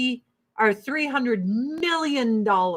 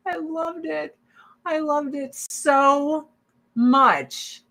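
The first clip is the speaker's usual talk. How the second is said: laughing fondly; 6 semitones higher, slower than usual. Real, not acted. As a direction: relive the joy through a laugh. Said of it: "it is a fucking joy".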